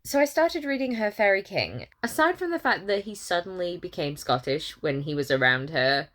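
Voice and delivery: ordinary delivery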